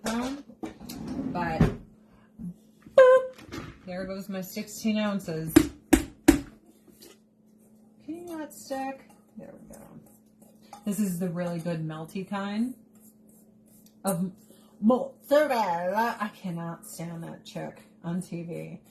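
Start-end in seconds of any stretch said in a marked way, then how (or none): 3.0-3.6 high, loud little "boop"; playful boop. Real, not acted. Playful noise while adding cheese. 14.8-16.2 "mozzarella" drawn out with a put-on Italian flourish; mock-Italian mozzarella. Mocks TV cook's Italian pronunciation.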